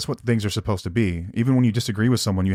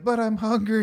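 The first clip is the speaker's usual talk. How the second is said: put-on much higher, childish whine; whiny little kid; 12 semitones higher than usual. Mom mimicking her little boy complaining, high childish whine. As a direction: mimic a small kid begging for food. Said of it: "little Johnny's like".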